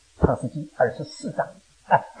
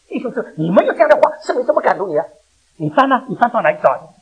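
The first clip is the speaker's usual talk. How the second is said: raised, pressing sermon questions; preaching exhortation; 8 semitones higher, 8 dB louder than usual. Acted. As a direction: challenge the congregation, loud and high. Exhorts the congregation.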